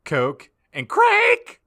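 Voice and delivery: high, loud, slow squeal; over-the-top delighted shriek; gleeful shriek. A narrator's reading. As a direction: squeal the last word with glee. Narrator shrieks drug list.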